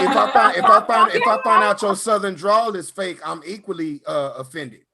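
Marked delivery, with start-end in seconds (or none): 0.0-3.2 higher and louder, stammering indignation; mock outrage. Real, not acted. Calling out fake Southern drawls, loud mock outrage. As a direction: rise up in mock offense. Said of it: "mock outrage building".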